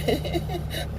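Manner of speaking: airy high mocking giggle. Mocking actors to his viewers, airy high giggle. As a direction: giggle like a silly celebrity. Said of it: "stupid actors and actresses".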